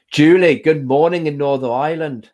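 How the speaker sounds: put-on a light, playful Irish accent on the greeting. Greeting a viewer in Northern Ireland, lightly put-on Irish accent. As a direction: greet her in a quick put-on Irish lilt. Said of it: "That was my Irish accent".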